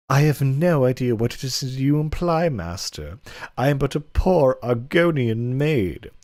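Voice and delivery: hammy, high coy servant voice; coy maid voice. Acted. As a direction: play the bashful Argonian maid, hammed up. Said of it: "inappropriate voice I can muster".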